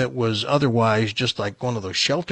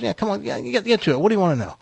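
lightly voiced impatient dog, higher pitch; higher voice, hurrying him to the point; talking dog; 8 semitones higher, faster than usual. Dog prods the shocked buyer, lightly voiced talking dog. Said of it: "He goes".

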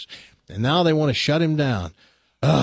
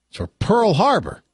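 louder, higher, hammed-up accusing punchline; angry accusation; 8 semitones higher, 4 dB louder than usual. Mock angry shout.

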